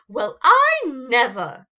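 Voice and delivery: slow, loud, haughty huff; offended sniff. Acted. Cynthia taking offence before leaving, haughty. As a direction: play the insulted grande dame. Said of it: "she sniffed".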